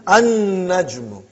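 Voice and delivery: "An-najmu" slow, with a long doubled n; lam silent. Shown as a demonstration. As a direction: hold the n in "An-najmu", no l at all. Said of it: "You did not pronounce lam".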